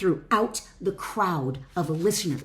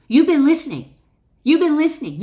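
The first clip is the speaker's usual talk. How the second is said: loud, higher, emphatic repeat; emphatic praise; 8 semitones higher, 9 dB louder, faster than usual. Real, not acted. Praising the listener for following along, loud and emphatic. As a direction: tell them proudly they've been listening.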